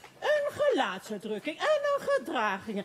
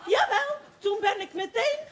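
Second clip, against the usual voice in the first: laughing; 6 semitones higher, 4 dB louder than usual. Acted. Excited gossip bursting out.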